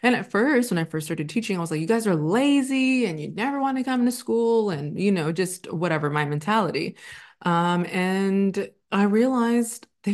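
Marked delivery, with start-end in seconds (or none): 1.8-4.7 higher-pitched mock complaint about students; retold scolding. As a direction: imitate your old judgmental teacher self, pitched up. Acting out old judgment of students.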